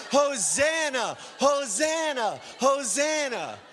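slow, loud, high Hosanna shouts; ecstatic crying out. Acted. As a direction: cry out with the multitude at full force. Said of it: "We are crying".

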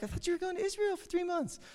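high, soft squeaky imitation of friends; mimicking doubtful friends; mock friends' voice. Friends' imagined disbelieving question.

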